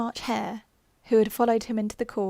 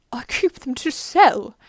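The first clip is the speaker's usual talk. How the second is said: Hatter; 3 semitones higher, 8 dB louder, slower than usual. Acted. Hatter explaining himself at trial.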